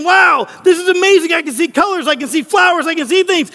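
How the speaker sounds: high-pitched, giddy amazement; acted breathless wonder, tumbling from thing to thing; all excited. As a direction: play someone dazzled by everything at once. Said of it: "he's all excited. He's kinda like A D D".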